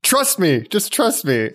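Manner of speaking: high-pitched, emphatic pleading in jest; playful, over-earnest urging; mock insistence. Mimicking the intense star's insistence.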